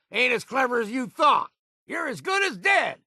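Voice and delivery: gleeful high battle shout; combat taunt shout. Acted. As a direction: bark it across the battlefield.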